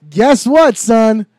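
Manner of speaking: loud, teasing setup for the gag; teasing his friend with the old joke, loud. Acted. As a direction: call it out like a schoolyard joke. Setting up chicken butt joke.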